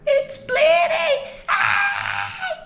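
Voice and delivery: very high, drawn-out shriek; loud mock scream, stretched out; imitated child's screaming. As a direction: wail it like a hurt child seeing blood. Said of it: "Começou a gritar".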